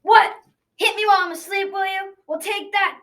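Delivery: high, indignant outburst; outraged mother giant. Acted. Mother giant hit while asleep, outraged.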